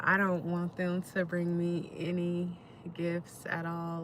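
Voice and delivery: usual speaking voice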